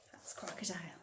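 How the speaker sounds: whispered naming. Shown as a demonstration. Showing the crocodile sign to children, whispered. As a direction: breathe it out as you sign. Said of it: "whispered sign aside".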